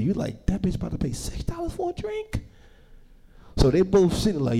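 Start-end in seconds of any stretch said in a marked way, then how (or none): 0.5-2.4 much higher, softer disbelieving aside; shocked aside. Acted. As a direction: gasp at the price under your breath. Shocked aside about price.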